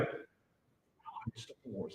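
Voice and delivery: very soft, slow whisper; imitated shy little girl whisper. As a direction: barely breathe it out, slowly, like a secret. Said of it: "And she went".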